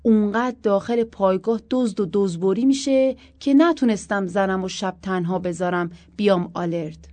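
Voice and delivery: firm. Acted. Abbas telling his superiors firmly why he brought his wife, loud and firm. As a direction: state it firmly to the officers. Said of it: "عباسم خیلی محکم گفت".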